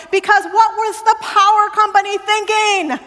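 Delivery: comic rhetorical outburst; exasperated. Retold or imitated. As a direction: throw the question out in mock outrage. Exasperated punchline to congregation.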